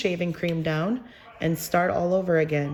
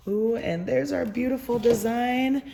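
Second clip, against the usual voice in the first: delighted; 5 semitones higher than usual. Real, not acted. Reveals the finished design.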